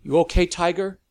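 sharp loud check-in; snapped. A narrator's reading. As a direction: snap it out fast. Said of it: "Lavinia snapped".

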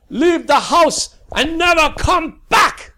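loud slow roaring order; furious father. Acted. Father banishing his daughter, furious loud shout. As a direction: banish her from the house.

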